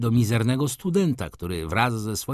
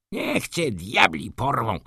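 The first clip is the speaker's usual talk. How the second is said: slow, mild angry burst; a hint of an irritated outburst; student snapping at his friend, mildly angry; 3 semitones higher than usual. A narrator's reading. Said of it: "wybuchnął pan Patkiewicz".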